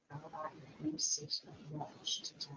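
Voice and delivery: soft whisper to a shy puppet. Acted. Teacher coaxing the shy puppet, whispering. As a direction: coax the shy one gently, in a whisper.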